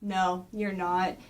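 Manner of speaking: low, slow, quiet denial; menacing Shredder. Retold or imitated. Shredder answering the turtle's taunt, low slow villain. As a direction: drop low and draw it out. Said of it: "he's going like".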